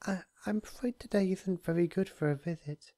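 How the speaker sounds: high, timid apologetic voice; gentle, hesitant pony voice; apologetic Fluttershy. A narrator's reading. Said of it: "Fluttershy continued apologetically".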